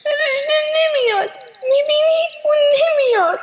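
high-pitched tearful wail; crying. Acted. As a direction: cry it out in despair, pitch way up.

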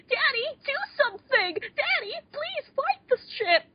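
high-pitched damsel-in-distress mock cry; panicked plea imitation. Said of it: "her snap reaction is".

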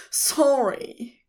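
high, subdued tearful apology; sobbed. A narrator's reading. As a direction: apologise through a mild acted sob. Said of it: "Crowley sobbed".

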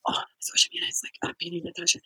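hushed, breathy report of the beating; retold whisper. Kids tell the narrator what is happening, whispered. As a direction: whisper it like a frightened child.

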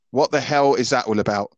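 ordinary delivery

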